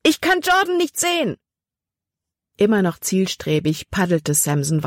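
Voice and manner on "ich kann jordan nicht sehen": very high, frantic cry; panicked cry. A narrator's reading. Libby cries out in panic as Jordan vanishes.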